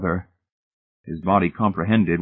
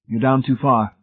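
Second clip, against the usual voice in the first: matter-of-fact verdict, slightly higher voice; dispassionate; 5 semitones higher than usual. A narrator's reading. Rescuer explaining why he cannot reach, dispassionately. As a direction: report it plainly, no emotion. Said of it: "Laws said dispassionately".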